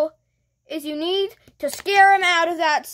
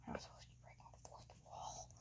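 barely audible whisper; hushed, hurried aside; 36 dB quieter, faster than usual. Acted. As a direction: whisper it quickly to yourself. Muttered aside to self.

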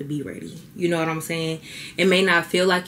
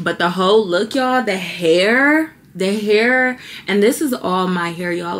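high and loud, stretching out the hair; excited showing off; 3 semitones higher, 6 dB louder than usual. Real, not acted. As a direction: gush over the hair, drawing it out. Showing off her thick hair.

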